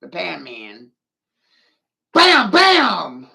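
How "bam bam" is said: loud, higher "Bam, bam!" shouted out; triumphant shout. Real, not acted. Ranting comic to his viewers, a loud triumphant shout. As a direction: slam it home like a punchline.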